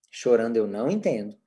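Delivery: low, even set phrase; calm, neutral refusal; unchanging intonation. Shown as a demonstration. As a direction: keep it unemotional, no scolding. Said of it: "sem mudar a entonação de voz".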